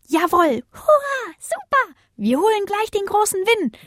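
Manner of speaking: sehr hohe, jubelnde Stimmen; jubelnd. A narrator's reading. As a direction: juble begeistert los. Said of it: "jubelten die Sternchen". (hoher begeisterter Jubel)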